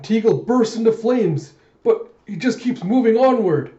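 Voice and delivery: high, loud, excited commentary; arena announcer. Retold or imitated. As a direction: call the action out over the crowd. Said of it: "the announcer calls out".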